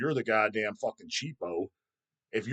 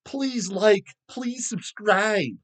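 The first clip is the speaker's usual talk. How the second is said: higher, slow, syrupy please subscribe; mock begging youtuber; 7 semitones higher, much slower than usual. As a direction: parody a needy youtuber pleading. Mocking other YouTubers' begging.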